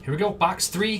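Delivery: usual speaking voice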